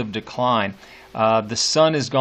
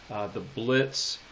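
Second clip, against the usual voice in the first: ordinary talk, nothing marked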